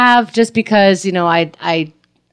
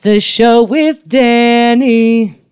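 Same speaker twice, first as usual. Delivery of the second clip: announcer voice; 4 semitones higher, 5 dB louder, much slower than usual. Acted. Podcast intro announcement.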